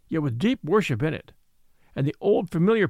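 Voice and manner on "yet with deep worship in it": usual speaking voice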